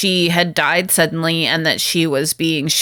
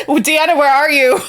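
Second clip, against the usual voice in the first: high, laughing cry for Deanna; laughing call; 6 semitones higher, 3 dB louder than usual. Retold or imitated. Picard calling for Deanna while laughing. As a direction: call out through a laugh, half-mock panic.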